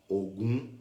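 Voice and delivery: Ogum said low, rising on the last syllable; tone variant. Shown as a demonstration. Teacher showing students how accents change Ogum, low rising tone. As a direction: say Ogum with a gently rising end. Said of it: "conforme muda a acentuação, muda tudo".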